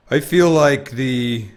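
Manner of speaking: failed deep-voice try; slow dude voice attempt. Shown as a demonstration. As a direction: stretch it out reaching for deep. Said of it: "can't have a deep enough voice".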